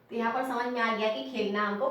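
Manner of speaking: ordinary talk, nothing marked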